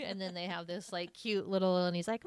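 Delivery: ordinary delivery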